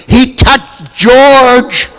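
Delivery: high, very slow, drawn-out squeal; hammed-up fan squeal; mock fan shout. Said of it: "somebody shouting".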